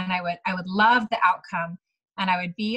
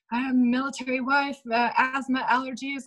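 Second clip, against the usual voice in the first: nervous; 4 semitones higher than usual. Retold or imitated. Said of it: "I was so nervous. I was shaking".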